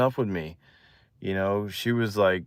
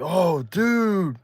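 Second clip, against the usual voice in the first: high-pitched retold amazement; blown away; 8 semitones higher than usual. Boy as girl takes his hand, blown away. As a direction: marvel with voice lifted high. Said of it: "I'm just blown away".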